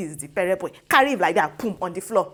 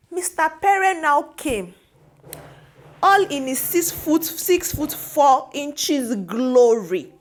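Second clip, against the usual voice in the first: slow, raised, drawn-out grand announcement; mock-grand; 6 semitones higher, 4 dB louder, much slower than usual. Vlogger telling viewers of Pere's towering entrance, mock-grand. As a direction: herald his entrance, stretching the words out.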